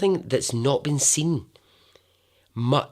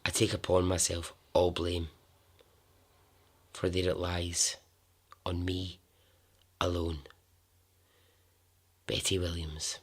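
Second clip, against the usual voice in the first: hushed, sombre reading with long pauses; solemn letter reading; 4 semitones lower, 7 dB quieter than usual. Acted. Storyteller reads a girl's last letter before her death, hushed and solemn. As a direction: read it softly and evenly, weighing each phrase.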